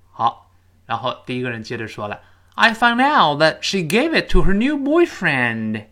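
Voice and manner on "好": usual speaking voice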